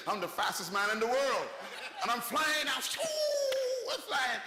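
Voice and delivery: high, laughing shout of thrill; high whooping exhilaration; exhilarated. Retold or imitated. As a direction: crow with joy at the speed.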